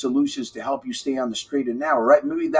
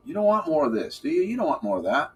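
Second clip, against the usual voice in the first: ordinary delivery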